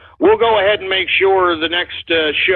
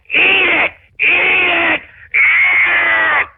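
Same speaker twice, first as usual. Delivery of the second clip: gloating shout; 10 semitones higher, much slower than usual. Real, not acted. Gloating at liberals on air.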